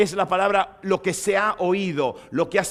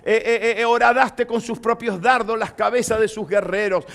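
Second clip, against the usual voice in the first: fervent, louder scripture reading; preaching reading; 5 semitones higher, 6 dB louder than usual. Acted. Preacher reads Habakkuk chapter three to the congregation, fervent. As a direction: proclaim the psalm with rising force.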